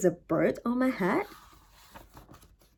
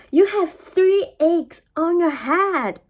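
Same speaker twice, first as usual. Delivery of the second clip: announcing; 6 semitones higher, 5 dB louder than usual. Acted. Piggy announces eggs.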